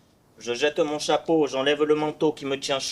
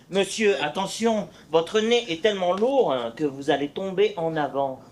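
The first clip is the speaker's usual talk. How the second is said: ordinary talk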